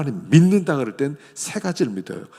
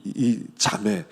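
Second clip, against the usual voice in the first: ordinary talk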